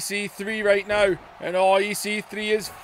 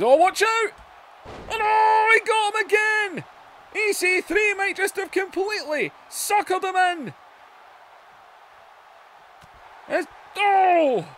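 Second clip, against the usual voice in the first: much higher, shouted call; excited shout; 11 semitones higher than usual. Real, not acted. Commentator calling a second slam, high excited shout. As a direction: shout it as the move hits. Big move called live.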